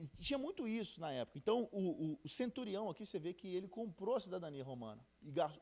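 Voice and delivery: ordinary delivery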